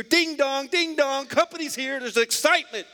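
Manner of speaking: high, excited doorbell chime; retold excitement. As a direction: build the thrill of guests arriving. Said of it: "He's getting everybody excited".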